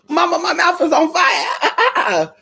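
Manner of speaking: high, slow, half-crying whine, laughing through it; whiny kid voice. Retold or imitated. Dog whining to mama about burning mouth, high teary voice. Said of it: "act like his mouth burning".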